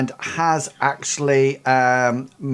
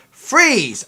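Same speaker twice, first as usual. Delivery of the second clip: sharp high guard's shout of freeze; a security guard barks at the player to freeze; 11 semitones higher, 5 dB louder than usual. Acted. Guard yells a command at the intruder, high and loud. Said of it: "the guy comes up behind you and says".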